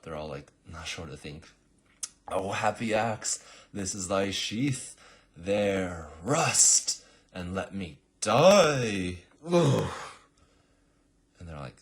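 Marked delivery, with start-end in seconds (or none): 2.3-9.2 loud, slow, higher tragic declaiming; milk every word of a stage death; melodramatic death speech. Acted. The assassin dies on his own axe, hammed-up tragic verse. 9.5-9.9 death groan. Acted. Assassin dies.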